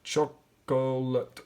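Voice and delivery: chocolate stretched slowly, syllable by syllable. Shown as a demonstration. Teacher sounding out a word for students, slowly. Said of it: "I'm gonna sound this out".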